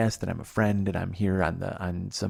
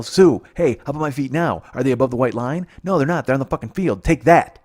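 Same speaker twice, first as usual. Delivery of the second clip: loud, raised taunting shout; defiant yell; 4 semitones higher, 8 dB louder than usual. Acted. Man taunting the usher from the field, yelling defiantly. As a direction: yell it across a ballpark in triumph. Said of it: "and just yell".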